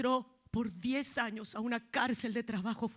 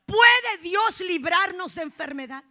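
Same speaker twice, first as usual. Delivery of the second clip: loud, high booming question; 7 semitones higher, 13 dB louder than usual. Real, not acted. Preacher challenging the congregation, loud and high. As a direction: call it out to the room.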